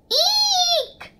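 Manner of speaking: very high, loud startled shriek; shrieked eek. A narrator's reading. Mama Bird seeing her chicks hanging, high shriek. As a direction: shriek like a frightened mother bird. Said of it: "she cried".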